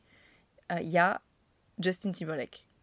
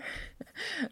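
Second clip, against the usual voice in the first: embarrassed laugh; 13 semitones higher, 9 dB quieter than usual. Real, not acted. Embarrassed at own fumble.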